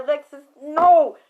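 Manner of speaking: loud, urgent command; shouted warning. Retold or imitated. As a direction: yell at her to stop. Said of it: "he said".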